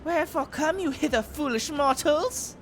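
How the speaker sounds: high haughty boss voice; lofty wind goddess, disdainful; garuda. Acted. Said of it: "she sounds like a Persona boss".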